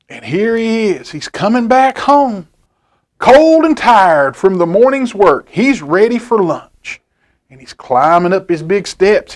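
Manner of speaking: plain reading voice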